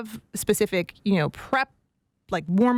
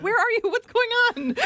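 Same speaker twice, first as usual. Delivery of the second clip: surprised; 13 semitones higher than usual. Retold or imitated. Aunt reacting to Boston-sounding niece.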